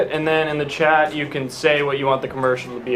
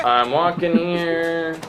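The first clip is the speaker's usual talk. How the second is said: put-on New York accent; 3 semitones higher, slower than usual. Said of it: "Someone complaining about the fact that Jill's in the street".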